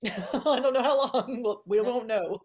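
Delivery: slow, laughing through the words. Real, not acted. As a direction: giggle it out, amused. Laughing at her mermaid joke.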